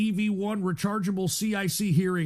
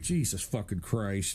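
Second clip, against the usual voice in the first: exasperated groan; 10 semitones lower, 6 dB quieter than usual. Real, not acted. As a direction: drag it out like a long-suffering sigh.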